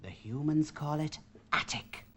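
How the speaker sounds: secretive whisper. Acted. Paint box telling the chocolate box about the attic in a whisper. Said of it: "said the paint box in a whisper".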